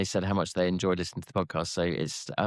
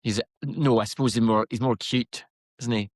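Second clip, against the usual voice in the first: put-on Scottish accent; musing, in a put-on Scottish accent. Shown as a demonstration. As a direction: muse in a Scots accent. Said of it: "It's so good".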